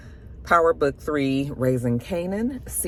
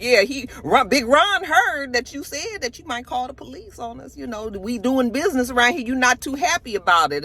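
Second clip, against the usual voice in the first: put-on Kanan, no accent; 8 semitones higher than usual. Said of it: "I'm not even gonna try to do his stupid, um, accent today".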